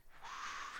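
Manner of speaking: blown-out whoosh of air; long airy wind noise; imitated wind gust.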